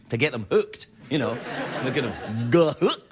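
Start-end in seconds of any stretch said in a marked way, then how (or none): 1.8-3.1 slow dopey cartoon dog voice, hook drawn out; Goofy. Russians hooked on Disney, said as Goofy in a slow dopey voice. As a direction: do Goofy, dragging it slowly. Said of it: "I can do a Goofy impersonation".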